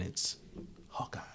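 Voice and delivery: hushed dramatic reveal; whisper. Real, not acted. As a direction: breathe the name like a secret.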